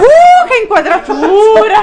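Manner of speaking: laughing. Real, not acted. Laughing at a cheeky camera shot, laughing. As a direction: laugh through the words.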